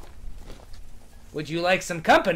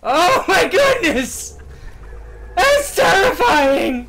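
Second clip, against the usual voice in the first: high, loud, slow terrified shriek; shrieking in fright; 12 semitones higher, 9 dB louder, much slower than usual. Real, not acted. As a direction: shriek it out, truly scared. Reacting to frightening game moment.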